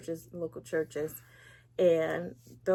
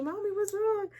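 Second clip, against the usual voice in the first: daughter voiced; 12 semitones higher than usual. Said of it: "my daughter's like".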